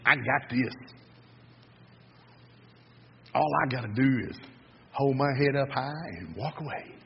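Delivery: soft, self-assured aside to himself; inner thought. Acted. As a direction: think it aloud, quietly cocky. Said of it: "he's thinking".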